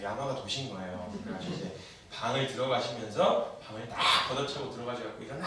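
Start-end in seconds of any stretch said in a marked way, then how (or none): 5.2-5.5 sudden burst of imitated motherly temper; mock angry yell. Mother storms into the bedroom, mock angry yell. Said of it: "이러면서 막 승질을 내면서".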